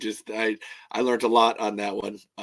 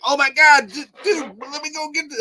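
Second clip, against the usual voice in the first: excited; 13 semitones higher, 6 dB louder than usual. Retold or imitated. Retelling excited reaction.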